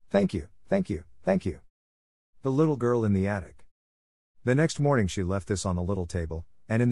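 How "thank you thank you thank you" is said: high, earnest repeated thanks; fervent thanks. A narrator's reading. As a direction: pour out gratitude, voice lifted high. Grateful letter climax.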